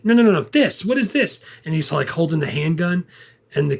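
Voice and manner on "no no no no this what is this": high, louder mock exasperation; Martin Short. Dad questions them about a handgun, high exasperated demand. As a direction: insist urgently, pitch jumping up.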